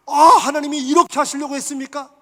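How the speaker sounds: a loud, high astonished question; loud amazed cry. Acted. Preacher voicing Paul, amazed at God's plan. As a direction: cry it out in wonder. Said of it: "하나님의 뜻을".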